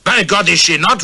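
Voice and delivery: the khan roaring back at his rival. A narrator's reading. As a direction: bellow the challenge. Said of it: "roared Sher Afzal".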